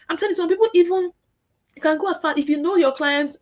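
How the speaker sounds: ordinary talk, nothing marked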